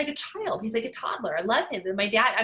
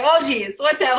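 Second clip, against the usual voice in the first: mock terrified; 4 semitones higher, 7 dB louder than usual. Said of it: "I'm like".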